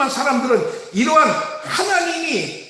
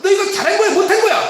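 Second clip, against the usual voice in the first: raised, pressing parent demanding an answer; pitched-up mock interrogation of a child; browbeating parent; 6 semitones higher, faster than usual. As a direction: corner the child with questions. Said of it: "윽박지르는 이유가 뭐예요".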